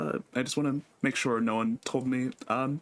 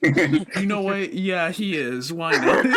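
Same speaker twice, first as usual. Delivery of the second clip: laughing; 7 semitones higher, 6 dB louder, slower than usual. Real, not acted. GM laughing, going along.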